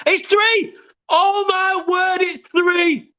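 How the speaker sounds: high, drawn-out screaming; goal yell. Real, not acted. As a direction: bellow the third goal. Third goal celebration.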